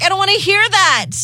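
high, whiny brush-off; mock whine to shut the coach up; a hint of a sulky teen; bratty retort. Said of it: "And I'm like".

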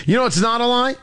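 ordinary talk, nothing marked

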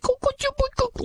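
very high soothing coo; cooing pet sounds. Retold or imitated. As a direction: coo like petting a kitty. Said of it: "petting the kitty".

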